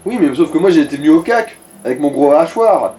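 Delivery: ordinary delivery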